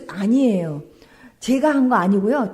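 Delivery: ordinary talk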